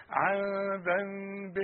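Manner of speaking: عن ذنب said slowly, nun hidden, lips apart; slow, nasal-free demo of عن ذنب; ikhfa of the nun. Shown as a demonstration. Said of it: "خلي شفايفك تخاصم بعض".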